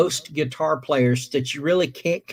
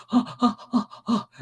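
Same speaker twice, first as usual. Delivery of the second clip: higher, imitated eager panting; excited panting; 6 semitones higher than usual. Kid waits on his idol's answer, panting excitedly. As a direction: mimic an excited kid's quick gasps. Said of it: "you could tell he's just excited".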